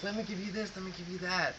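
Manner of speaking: high, soft mock fawning offer; eager townsfolk. Townsfolk pressing rewards on the hero, high and softly fawning.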